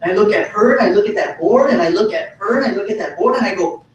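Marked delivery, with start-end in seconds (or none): none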